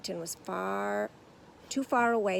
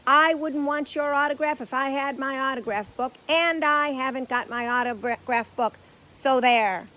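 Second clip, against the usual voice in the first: defiant; 5 semitones higher, 10 dB louder than usual. A narrator's reading. Paddington defiant.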